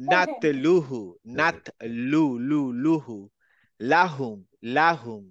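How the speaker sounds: নাতলুলুহু drawn out syllable by syllable, the lam clear; slow recitation example. Shown as a demonstration. Teacher reciting Arabic words slowly to show the lam. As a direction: slowly sound out each লা in লাহুম. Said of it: "যদি বলি".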